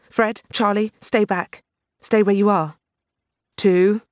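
loud, high commanding yell; yelled orders. A narrator's reading. Tash yells at colleagues to stay back. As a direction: bark the count as a warning. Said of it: "Tash yelled".

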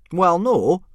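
high, loud Gobber voice admitting it; sheepish admission. A narrator's reading. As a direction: own up grudgingly. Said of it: "Gobber admitted".

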